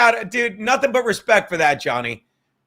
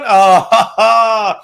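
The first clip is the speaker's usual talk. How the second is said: laughing; 7 dB louder, much slower than usual. Real, not acted. Laughing at the drink mix-up. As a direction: burst of laughter after the shot.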